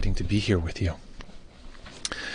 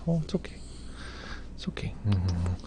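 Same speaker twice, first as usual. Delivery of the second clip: soothing a puppy; 8 dB quieter than usual. Real, not acted. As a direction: hush a little dog tenderly.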